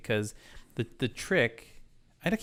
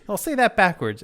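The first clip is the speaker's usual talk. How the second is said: higher, louder mocking voice; mocking quote; 8 semitones higher, 8 dB louder than usual. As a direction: tease him higher and louder. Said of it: "And then you say".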